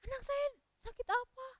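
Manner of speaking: high-pitched, hammed-up distress; mock tearful wail. Storyteller resumes the mother asking the doctor, high mock wail.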